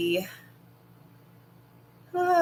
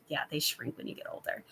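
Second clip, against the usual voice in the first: whispered aside; 5 semitones lower, 12 dB quieter, faster than usual. Real, not acted. Repeats a viewer comment, hushed.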